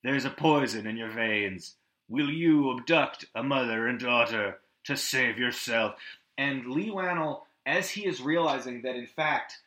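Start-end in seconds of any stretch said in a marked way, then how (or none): 0.0-6.0 lightly voiced tape voice. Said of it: "it's like".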